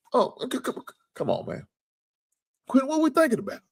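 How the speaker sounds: high, fast, disbelieving question; incredulous outcry. Real, not acted. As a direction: cry out in disbelief at the trade decision. Incredulous at a player trade.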